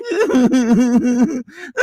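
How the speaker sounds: laughing. Real, not acted.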